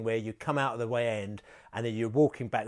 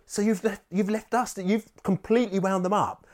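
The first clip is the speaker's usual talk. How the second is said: indignant disbelief; 10 semitones higher, 5 dB louder than usual. Retold or imitated. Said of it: "I thought".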